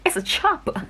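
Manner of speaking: loud, high-pitched comic disbelief; incredulous reaction. Retold or imitated. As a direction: stop everything in mock shock, phrase by phrase. Taken aback by Cuba rumour.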